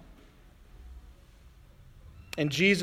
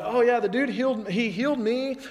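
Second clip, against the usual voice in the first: higher, offhand "oh yeah" voice; casual healed man; 6 semitones higher than usual. Retold or imitated. Healed man shrugging to religious leaders, higher and offhand. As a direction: toss it off like a careless guy. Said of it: "And the guy's like".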